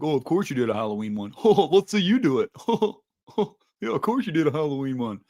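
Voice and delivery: mocking chat voice. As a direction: sneer like a heckler in the chat. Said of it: "like, clowning me".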